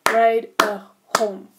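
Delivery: "Bruidegom" very slow and loud, syllable by syllable; "Bruidegom" with clear gaps between syllables; broken into syllables. Shown as a demonstration. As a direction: chop "Bruidegom" into separate beats. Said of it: "is at least two syllables".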